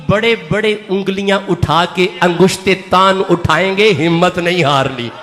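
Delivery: grave pastoral appeal; earnest low exhortation. Acted. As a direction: urge them gently but firmly not to lose heart. Lower, pleading exhortation.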